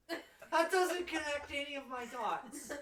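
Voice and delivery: loud, slow laughing disbelief. Acted. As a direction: laugh through baffled questions. NPC laughing, baffled.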